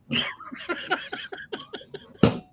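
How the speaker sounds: high breathless wheeze of a laugh; real wheezy laugh. Real, not acted. Laughing at his own joke to Patrick, wheezing. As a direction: laugh helplessly at the punchline.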